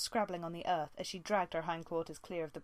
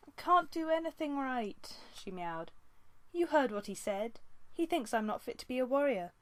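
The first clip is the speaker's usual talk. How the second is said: distressed; 6 semitones higher than usual. A narrator's reading. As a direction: pour out her despair to him. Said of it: "full of distress".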